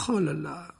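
put-on gently sorrowful girl; sad sigh. A narrator's reading. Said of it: "dit tristement Hermione".

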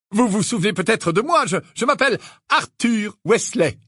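open and friendly. A narrator's reading. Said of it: "d'un ton aimable".

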